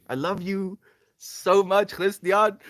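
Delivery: effusive thanks to a friend; affectionate. Real, not acted. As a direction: pour affection out to him.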